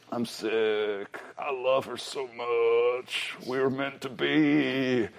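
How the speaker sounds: soft, slow Southern drawl, pining; soft, drawn-out Southern whine of a lovesick fool; mock Southern lovesick. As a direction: play a moony lovesick Southern boy, half joking. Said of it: "I don't know why Southern is like that to me".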